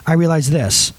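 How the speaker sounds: ordinary talk, nothing marked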